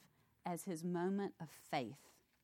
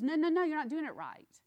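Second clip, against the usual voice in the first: loud, high protest of a son who is upset; mad son; 7 semitones higher, 8 dB louder, faster than usual. Retold or imitated. Son protesting to his blind father, loud and upset. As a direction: cry out that he's doing it wrong. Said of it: "Joseph gets mad and says".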